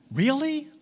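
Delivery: high, loud mock disbelief; incredulous really. Mocking an exaggerated claim.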